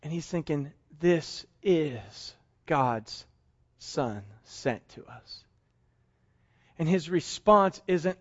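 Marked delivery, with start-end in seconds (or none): 1.0-5.5 very slow, low, hushed awe; whispered reverence; awed hush. Acted. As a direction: let the realization sink in. Peter's awed realization.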